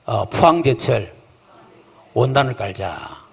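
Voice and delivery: slow, deliberate lead line; slow call-and-response. Shown as a demonstration. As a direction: say it slowly for the crowd to repeat. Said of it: "따라합시다". (따라하도록 천천히)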